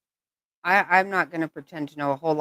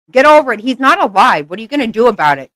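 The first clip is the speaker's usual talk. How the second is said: angry yell; 4 semitones higher, 7 dB louder than usual. Real, not acted. Yelling at [name] over [name].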